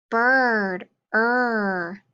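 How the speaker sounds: "bird" then its vowel "er" alone, drawn out; sound card drill. Shown as a demonstration. Teacher drilling sound cards with the class, vowel held. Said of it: "get ready for our sound cards".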